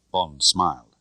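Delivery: plain reading voice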